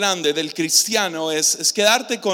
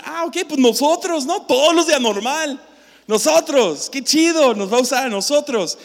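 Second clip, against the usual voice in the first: higher, louder cheerful boast; smug disciples; 7 semitones higher, 5 dB louder than usual. Retold or imitated. Disciples boasting to each other, higher and louder. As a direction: crow with delight that it's only us.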